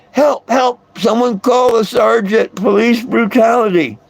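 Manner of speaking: a mild mock yell, high and urgent; retold yell for help. Man retelling his arrest to viewers, mock yelling for help. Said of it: "And I'm yelling".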